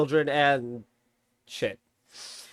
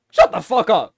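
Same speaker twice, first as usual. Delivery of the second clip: louder, firm shut-up; loud exasperated telling-off; angry retort; 3 semitones higher, 7 dB louder than usual. Real, not acted. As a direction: tell the complainers off hard. Snapping at complaining fans.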